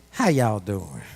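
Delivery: lightly imitating Southern folks; Southern accent, lightly imitated; Southern greeting. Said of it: "the South people, they say".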